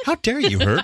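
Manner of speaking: high mock-offended gasp of a question; mock outrage. Wife acting offended at her husband, mock outraged. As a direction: play the insulted wife, hamming it up.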